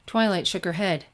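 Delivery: plain reading voice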